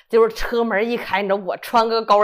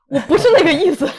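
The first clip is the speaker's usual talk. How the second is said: louder, laughing through the words; 5 semitones higher, 7 dB louder than usual. Real, not acted. To God, laughing as she takes her prayer back. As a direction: giggle out the excuse.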